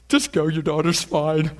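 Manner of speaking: delighted words through a laugh; excited, laughing. Acted. Jesus sending the woman home, delighted laughing. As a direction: laugh it out, thrilled. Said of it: "It really excited Jesus".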